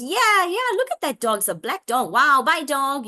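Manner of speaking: put-on high, excited parent talking to a toddler; enthusiastic papa. Papa answering his child about a dog, imitated excitement. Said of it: "And then the, the papa goes".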